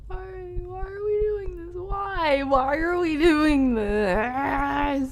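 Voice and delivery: high panicked whimpering plea; scared crying. Real, not acted. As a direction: wail it in terror. Terrified in horror game.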